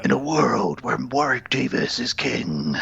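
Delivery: slow, husky trailer announcer; movie trailer voice. Pretend. Mock trailer for a Warwick Davis film, trailer voice. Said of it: "trailers for movies".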